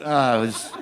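normal talking voice

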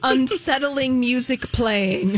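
slow, deadpan caption reading with a laugh; mock-ominous caption read. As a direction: read it like a spooky subtitle. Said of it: "here are some of the closed captions".

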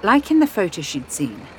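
ordinary delivery, nothing marked

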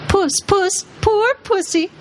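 very high taunting puss puss; mocking jeer. A narrator's reading. Rabble taunting Aslan like a cat, high mocking coo. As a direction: coo it in a mock sweet squeal. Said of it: "jeering at him".

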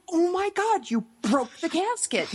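mock horror at a broken cross; shocked brothers. Brothers catching her snapping the casket cross, high and aghast. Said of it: "brothers were like".

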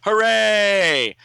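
high loud cheering hooray; crowd cheer. Acted. Townsfolk cheer the hero loudly and high. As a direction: cheer like a whole grateful crowd. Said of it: "and everyone goes".

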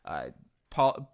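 ordinary talk, nothing marked

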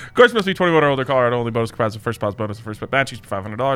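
rapid-fire disclaimer; fast legal disclaimer. Real, not acted. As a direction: rattle off the fine print fast. Sportsbook ad disclaimer read fast.

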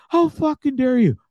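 high, loud mock outrage; scandalized mock scolding; upset buddy. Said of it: "he is upset".

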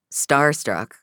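ordinary delivery

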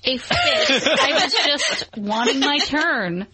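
laughing mock offense; mock indignant. Real, not acted. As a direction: playfully protest through laughter. Denying she pitched a fit.

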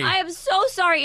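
high, loud, panicked apologizing; frantic apology. Retold or imitated. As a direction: re-enact a mother gushing apologies. Mother retells frantic apology to son.

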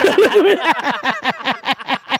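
laughing warning. Retold or imitated. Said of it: "Cortland was like".

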